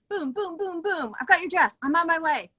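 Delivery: high, punchy re-enactment of a rush; excited play-by-play of a whirlwind; rapid fire. Retold or imitated. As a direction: rattle off each step in quick bursts. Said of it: "it was like rapid fire".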